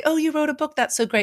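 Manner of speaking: high, bright mock gush; high mock praise from acquaintances; gushing admirer. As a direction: imitate people fawning over the book. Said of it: "people would say".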